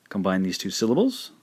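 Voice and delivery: ordinary talk, nothing marked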